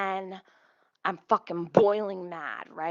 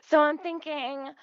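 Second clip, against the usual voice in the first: normal talking voice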